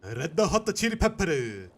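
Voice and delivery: put-on slow, loud Japanese accent call. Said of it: "Japanese they do pronounce the R".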